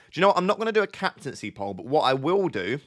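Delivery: ordinary talk, nothing marked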